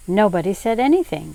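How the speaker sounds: plain reading voice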